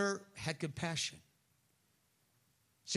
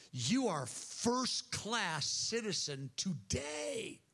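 high, drawn-out declaration; slow, ringing pronouncement with raised pitch; emphatic proclamation; 5 semitones higher, much slower than usual. Acted. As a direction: proclaim each word slowly as the father. Father declares son restored.

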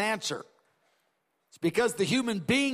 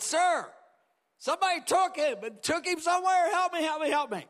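high, pleading cry of a grieving woman; desperate pleading; 8 semitones higher than usual. Acted. Mary begging the gardener where they took Jesus, high desperate plea. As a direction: beg the gardener to tell where the body is.

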